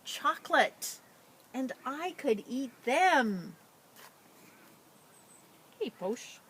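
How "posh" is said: ordinary delivery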